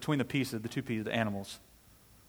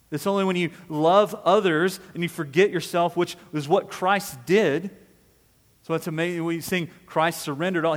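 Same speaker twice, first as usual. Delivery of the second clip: preaching; 4 semitones higher, 10 dB louder than usual. Real, not acted. Preacher telling the congregation to forget themselves, impassioned preaching. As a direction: drive it home, voice rising in pitch as it builds. Preacher builds to full force.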